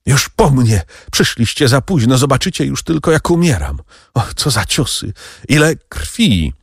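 high, pained dying lament; heartrending moan. A narrator's reading. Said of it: "Kadrus jęczał wciąż rozdzierająco". (rozdzierający jęk umierającego)

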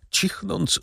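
plain reading voice

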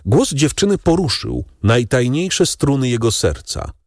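usual speaking voice

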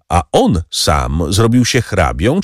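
ordinary delivery

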